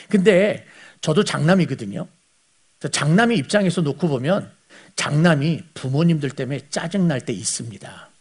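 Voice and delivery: ordinary talk, nothing marked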